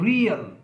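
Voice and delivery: रियल said high and loud as ree-ul; emphatic model word. Shown as a demonstration. Teacher presenting the example word to students, loud and high. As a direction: sing out रियल as a two-part glide. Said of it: "उसी तरह से".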